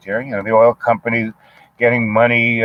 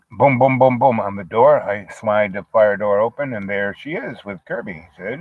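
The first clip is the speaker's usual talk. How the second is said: ordinary delivery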